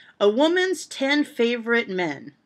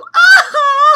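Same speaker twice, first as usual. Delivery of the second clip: high cackle; 15 dB louder than usual. Real, not acted. Laughing at terrible joke.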